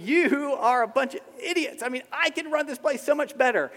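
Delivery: high, loud mock outrage; hammed-up, high-pitched know-it-all complaint; scornful boast. Preacher mimicking everyone who feels superior, loud mock scorn.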